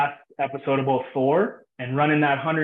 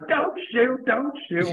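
mock yell; 8 semitones higher, slower than usual. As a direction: imitate a buddy yelling a panicked warning. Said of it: "Trev's always yelling".

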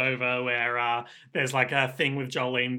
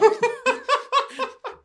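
laughing after the shout; burst of laughter. Real, not acted. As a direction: crack up at the joke.

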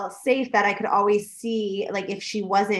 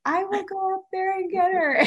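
brother-in-law; 10 semitones higher than usual. Retold or imitated. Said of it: "brother-in-law was like".

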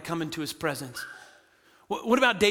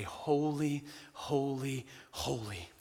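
reverent hush; 7 semitones lower, 11 dB quieter, slower than usual. Retold or imitated. Creatures' endless praise quoted.

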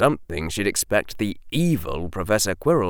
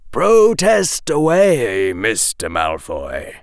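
casual and relaxed; 2 semitones higher, 5 dB louder, slower than usual. Acted. Professor Quirrell inviting Draco's protest, casual. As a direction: airily invite the complaint, slowly. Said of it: "casual and relaxed manner".

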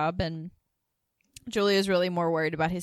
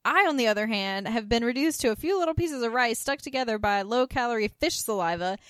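higher and louder, a huffy mock diva; imitating Suzanne; 5 semitones higher, 4 dB louder than usual. Suzanne scolding the others over her rice cakes, in a huffy mock diva voice. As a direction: act the wronged dieter with a lot of drama. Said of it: "She's like".

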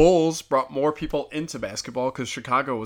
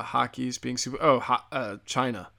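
ordinary delivery

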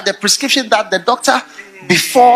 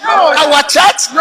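mock indignant question; 6 semitones higher, 4 dB louder than usual.

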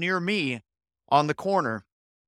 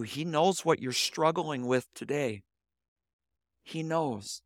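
much softer, sinking into a hushed close; hushed emphasis; 6 dB quieter than usual. Acted. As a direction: let the last words drop to a hush. Preacher drives home God's knowledge.

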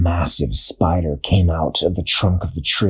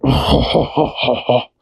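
spiders cackling together; a short eerie group laugh; creepy spider laugh; 3 semitones higher than usual. A narrator's reading.

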